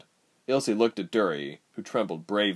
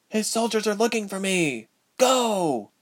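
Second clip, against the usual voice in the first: put-on urgent young woman; 12 semitones higher than usual. A narrator's reading. Princess urges escape from soldiers.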